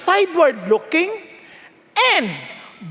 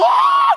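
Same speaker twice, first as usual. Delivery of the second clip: swooning squeal; 6 dB louder than usual. Retold or imitated. Mimicking swooning women.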